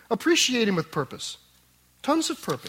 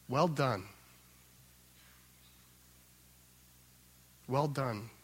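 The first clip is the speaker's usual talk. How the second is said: tender praise; 6 semitones lower, 8 dB quieter, slower than usual. Acted. Master praising faithful servants.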